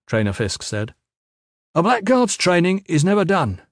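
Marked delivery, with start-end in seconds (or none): 1.7-3.6 high, raised instructor voice; trainer's maxim. A narrator's reading. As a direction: declare it to the whole line of trainees.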